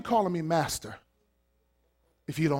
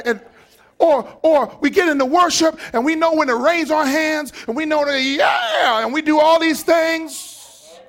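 usual speaking voice

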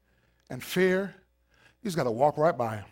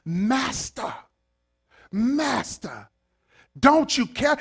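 high, desperate cry for help; frantic disciples pleading with their master; panicked scream; 8 semitones higher, 3 dB louder, much slower than usual. Retold or imitated. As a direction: scream it like the boat is sinking. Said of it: "the disciples came to him screaming".

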